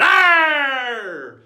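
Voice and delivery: long, high, very loud pirate "arr"; pirate roar. Acted. Crew member enjoying a rowdy pirate cry, roared out. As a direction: let rip a drawn-out piratical roar. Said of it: "they just enjoy going".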